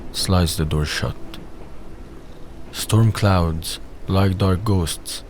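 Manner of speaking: ordinary delivery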